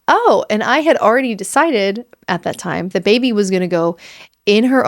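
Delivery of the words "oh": taken aback. Retold or imitated. Parents-to-be startled by the couple's advice, high surprised oh. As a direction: gasp it out, caught off guard. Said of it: "Logan and I were like".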